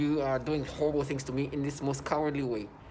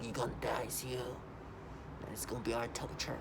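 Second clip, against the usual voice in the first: mock taunting voice; 4 semitones lower, 11 dB quieter than usual. Picturing herself speaking like the harassers, a hushed mock threat. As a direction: lightly play the tormentor, hushed. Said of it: "If I had to make myself talk".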